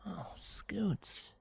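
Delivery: breathless, barely voiced whisper. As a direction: let it slip out, heartbroken. Moved response to crying filly.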